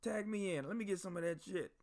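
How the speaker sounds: playfully tag in like a sergeant hungry to smoke recruits; drill sergeant cutting in. Retold or imitated. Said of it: "One drill sergeant was like".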